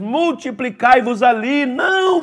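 loud, declaiming the verse; preached scripture. Acted. Pastor declaiming scripture to the church, loud. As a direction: proclaim the verse to the hall.

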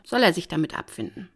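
usual speaking voice

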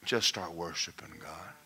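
hushed unvoiced whisper. Pastor confiding to the congregation, whispering. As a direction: lean in and whisper it. Intimate aside in sermon.

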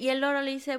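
plain reading voice

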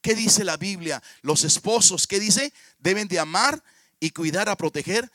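loud fervent sermon; preaching. Acted. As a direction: preach it with conviction, pressing each point. Predicación enérgica sobre familia. (predicador fervoroso exhortando a la congregación)

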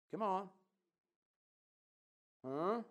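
ordinary talk

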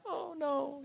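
small, high, soft lament; mock sadness. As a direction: coo it like a mildly tearful film viewer. Mock sadness at Mufasa dying.

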